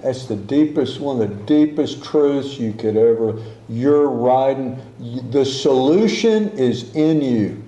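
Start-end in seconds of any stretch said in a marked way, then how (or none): none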